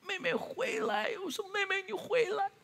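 a father in a dream calling after his runaway daughter, retold with a mild mock call. Father calls his fleeing daughter back in a dream, a stretched, high pleading call. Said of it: "我在梦里头一直大喊着说".

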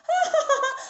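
high, put-on gloating laugh; high storybook villain's laughter; villainess laugh. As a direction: cackle as the smug princess. Princess laughs scornfully at Wukong.

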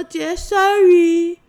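high, loud, drawn-out little-brother sorry; imitated cheerful apology. Little brother says sorry to big sister, cheerful imitation. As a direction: sing out a carefree child's apology. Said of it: "很开朗".

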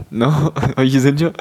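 usual speaking voice

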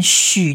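许 as a hushing "shü"; sh sound. Shown as a demonstration. Teacher shows the letter's sound, 许 with sh. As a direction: give 许 as the sh consonant. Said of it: "它在发音上念许的音".

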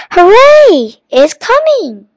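high, loud cheer; excited yell. A narrator's reading. Kipper sees the boat turn towards them, yelling. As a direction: yell it out jubilantly, pitch soaring. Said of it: "yelled Kipper".

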